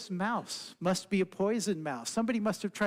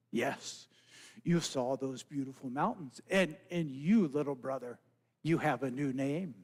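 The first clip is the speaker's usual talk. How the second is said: solemn frog; 7 semitones lower than usual. Acted. Brother Frog tells the mouse his new name, low and solemn. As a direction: speak like an old sage.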